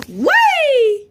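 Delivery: high swooping whee; playful glee. Acted. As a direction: squeal as if tossing a toy up. Toy thrown up in air.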